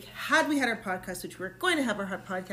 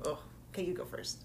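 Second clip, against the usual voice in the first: whispering; 3 semitones lower, 9 dB quieter, much faster than usual. Real, not acted. Bracing for smelling salts.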